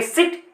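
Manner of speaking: सीट said as sit, with a short i; short vowel. Shown as a demonstration. Teacher contrasting short and long sound, short i in सीट. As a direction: make सीट quick and short. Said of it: "सीट एक short sound हो गया".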